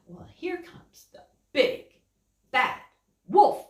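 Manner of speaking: ordinary delivery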